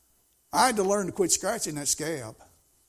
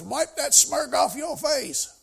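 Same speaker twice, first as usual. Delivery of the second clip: high, slow mock scolding; mock outraged lady; angry scold; 9 semitones higher, 7 dB louder, slower than usual. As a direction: snap it like an outraged church lady.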